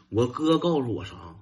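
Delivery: normal talking voice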